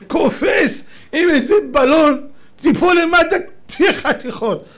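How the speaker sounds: hammed-up high, loud scolding; Persian father's alarm. Father warns son jumping with a parachute, loud alarmed scolding. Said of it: "אבא שלו היה בא אליו".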